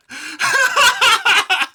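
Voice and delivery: high squealing burst of laughter; huge shrieking laugh. Real, not acted. Streamer shrieks with laughter at his lucky card. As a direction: shriek with delight at the pull. Unboxing a great card reveal.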